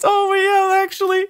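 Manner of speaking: high, breathless with laughter; laughing. Retold or imitated. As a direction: concede while cracking up. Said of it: "And I was just".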